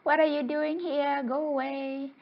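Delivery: small, high-pitched challenging fish voice; little guy. Acted. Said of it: "this little guy was like".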